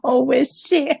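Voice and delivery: crying-laughing fondness. Real, not acted. Laughing fondly to the absent author. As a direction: laugh through the name in sympathy.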